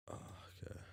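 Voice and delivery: whispering. Real, not acted. Whispered aside.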